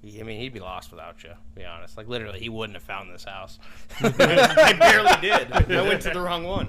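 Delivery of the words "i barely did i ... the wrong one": laughing. Real, not acted. Laughing at getting lost.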